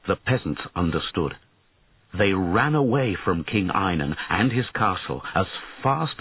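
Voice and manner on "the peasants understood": ordinary delivery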